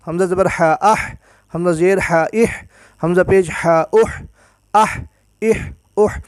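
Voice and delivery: each اح one syllable closing on an audible breath of h; audible final haa. Shown as a demonstration. Teacher shows the letter's sound must come out, sounded final h. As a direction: spell hamza, vowel and ہا, then join as ah, ih, uh. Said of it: "touch ہو تو اس کی آواز نکلے".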